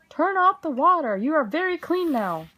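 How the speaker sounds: high, loud townspeople; crying out. A narrator's reading. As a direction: shout at the mouse. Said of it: "The people in the town cried".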